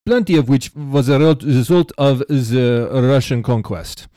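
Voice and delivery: a bigger, hammed-up Russian accent read aloud. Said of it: "going all in on this accent".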